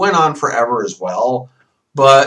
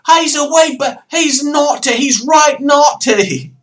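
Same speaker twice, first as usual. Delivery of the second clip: high, loud, hammed-up Cockney secretary; tarty secretary impression; 13 semitones higher, 6 dB louder, slower than usual. Vlogger mocking Penguin's secretaries, high squealing impression. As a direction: squeal it like a cheeky henchwoman. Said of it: "And his little tardy secretaries".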